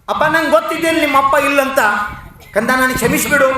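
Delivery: high, loud, emotional plea; fervent apology. A narrator's reading. Teacher asks the fatherless boy's forgiveness, fervently. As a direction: beg forgiveness with loving urgency. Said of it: "ಅತಿ ಪ್ರೀತಿಯಿಂದ ಅಪ್ಕೊಂತಾನ".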